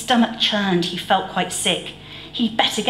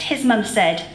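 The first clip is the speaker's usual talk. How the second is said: usual speaking voice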